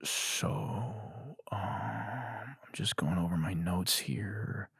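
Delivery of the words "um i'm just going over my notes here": soft slow whisper; whispered aside. Real, not acted. Comedian whispering to himself over notes. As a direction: whisper it to yourself.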